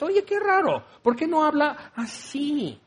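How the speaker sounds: a high, mocking imitation of a confused listener; mock puzzled visitor. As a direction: squeak out a baffled newcomer's question.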